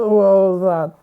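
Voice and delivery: surprised. Real, not acted. First look at camera.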